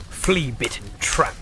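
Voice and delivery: ordinary delivery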